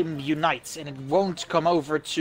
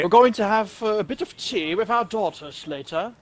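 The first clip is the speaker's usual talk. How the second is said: higher-pitched mock-British accent; put-on British accent; 4 semitones higher than usual. Shown as a demonstration. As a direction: play up a tea-time British accent. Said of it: "do your best British accent".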